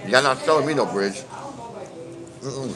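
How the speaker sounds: ordinary delivery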